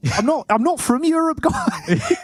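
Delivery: high, bright Aussie twang imitation; teasing a co-caster in Australian accent; mock Australian accent. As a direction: parrot the line in a hammed-up Australian accent. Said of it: "I like saying that in the Australian accent".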